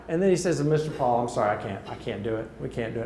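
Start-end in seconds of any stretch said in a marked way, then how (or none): 1.2-3.1 low, hushed retold apology; apologetic refusal. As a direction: drop the voice low and soft to let him down. Retelling the concierge's apologetic refusal.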